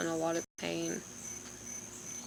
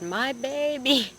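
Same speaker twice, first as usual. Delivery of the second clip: slow, drawn-out, higher mock cry of my baby; retold dramatic yell; 7 semitones higher, 5 dB louder, much slower than usual. Wife retelling to viewers how she cried out after the crash, as a mock movie yell. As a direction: ham it up like a movie mother. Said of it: "And Chase said I yelled".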